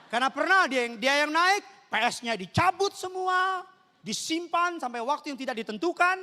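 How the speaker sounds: loud, high, emphatic sermon anecdote; full-force storytelling. Real, not acted. Preacher recounting mother's confiscations, loud high declaiming.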